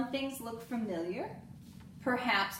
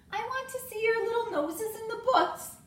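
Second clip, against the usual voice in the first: librarian voice; 13 semitones higher, 4 dB louder than usual. A narrator's reading. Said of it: "said Missus Stacks".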